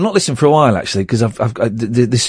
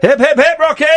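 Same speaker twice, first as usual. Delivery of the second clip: high-pitched chanted echo of a cheer; playful cheer; 13 semitones higher than usual. Real, not acted. Host echoing caller's catchphrase, high playful cheer. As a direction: echo it back high and jaunty.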